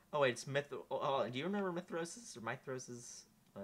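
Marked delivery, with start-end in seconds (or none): none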